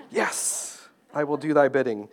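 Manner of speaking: hammed-up holy obedience; mock obedient servant. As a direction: play the devout servant answering God. Answering the Lord.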